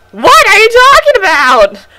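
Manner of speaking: high, loud put-on voice; puzzled camp stranger; pioneer character voice. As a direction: play the odd pioneer. Voicing the character.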